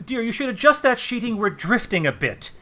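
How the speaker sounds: brisk lady of the ship; noble lady giving orders. Acted. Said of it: "points to one of the sails and says".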